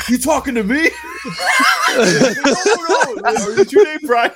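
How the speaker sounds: laughing through the quote. Real, not acted. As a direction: try to quote them but keep cracking up. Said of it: "I hear this guy go".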